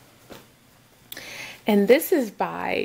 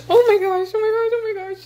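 high, breathless-with-joy repetition; real giddy, high-pitched delight; 10 semitones higher, 3 dB louder than usual. Real, not acted. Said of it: "I'm getting so excited like a little kid".

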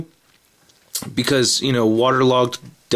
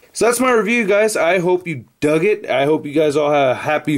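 upbeat sign-off; 6 semitones higher than usual. Real, not acted.

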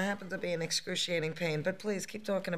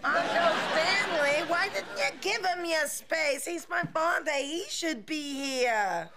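put-on whiny Queens accent; 7 semitones higher than usual. Fran whining at her uncle.

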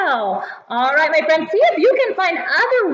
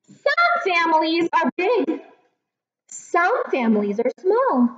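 put-on reading to a toddler; 3 semitones higher than usual. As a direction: read each page gently to kids. Reads picture book aloud.

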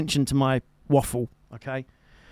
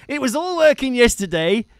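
put-on a mate phoning in a Devon accent; Phil's Devonshire accent; 7 semitones higher than usual. Imitating Phil ringing him, Devon accent. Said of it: "he always starts with that sentence".

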